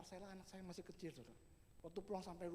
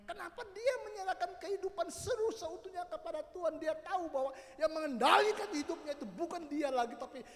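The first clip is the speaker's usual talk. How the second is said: loud, raised preacher's delivery; full-force preaching; 10 semitones higher, 14 dB louder than usual. Real, not acted. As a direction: preach it out with hard bursts on key words. (khotbah berapi-api dengan suara lantang)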